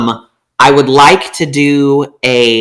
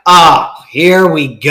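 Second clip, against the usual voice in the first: slow, loud, put-on weary drawl; weary here-we-go; 5 dB louder, slower than usual. Speaker hearing the insult, a slow mock weary sigh. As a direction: roll your eyes at it, drawn out.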